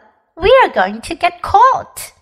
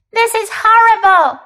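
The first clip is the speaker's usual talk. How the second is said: tearful dismay; 6 semitones higher, 4 dB louder than usual. A narrator's reading. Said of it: "wiping her eyes with a tissue".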